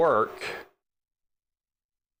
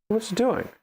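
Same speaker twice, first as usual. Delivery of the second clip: higher, incredulous at the printer; baffled, pitched up at the machine; exasperated disbelief; 6 semitones higher than usual. Real, not acted. Exasperated maker asking his printer, higher and incredulous. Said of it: "I'm getting worked up for no reason".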